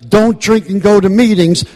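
preacher-like shouted slogan; loud passionate repeat. Real, not acted. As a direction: hammer the slogan with full force.

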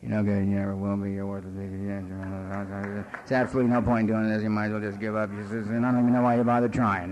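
low, cutting self-talk, hinted only; inner critic voice. Retold or imitated. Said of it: "head just raging".

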